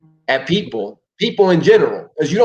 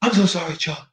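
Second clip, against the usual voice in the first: lightly higher pleading sorry; mock profuse apology; 3 semitones higher, faster than usual. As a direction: grovel over and over.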